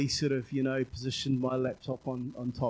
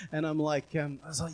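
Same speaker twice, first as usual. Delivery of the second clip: ordinary talk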